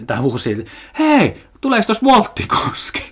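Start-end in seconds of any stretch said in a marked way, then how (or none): 1.0-3.0 high, slow kid's call, mocked; imitating a kid's shout. Neighbour's kid calling at passerby, high mock child voice. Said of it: "se huusi mulle".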